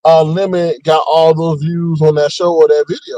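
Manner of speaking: ordinary talk, nothing marked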